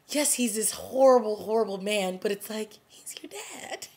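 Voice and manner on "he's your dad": high, very soft, drawn-out near-whisper; hushed disbelief. Real, not acted. Reviewer aghast that a daughter shot her dad, hushed disbelief. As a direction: squeak it out slowly under your breath.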